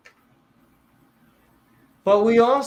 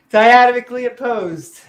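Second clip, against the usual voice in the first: dramatic emphasis; 6 semitones higher, 10 dB louder than usual. Pretend.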